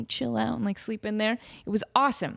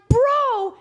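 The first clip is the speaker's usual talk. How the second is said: exasperated; 6 dB louder than usual. Retold or imitated. Cat reappears, exasperated.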